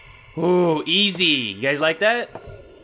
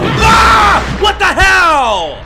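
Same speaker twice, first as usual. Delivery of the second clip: scared scream; 13 semitones higher, 11 dB louder, much slower than usual. Real, not acted. Jump scare in horror game.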